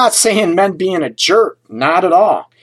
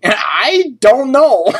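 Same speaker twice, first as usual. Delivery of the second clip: laughing; 6 semitones higher, much slower than usual. Real, not acted.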